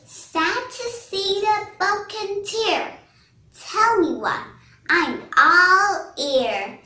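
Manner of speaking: sympathetic monkey. Acted. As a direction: lightly voice a concerned storybook monkey. Monkey consoles the crying parrot.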